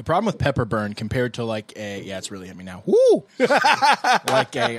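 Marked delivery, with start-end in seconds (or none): 2.9-4.8 high loud whoop then a laugh; woo from the heat. Real, not acted. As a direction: whoop as the burn hits. Pepper burn hitting hard.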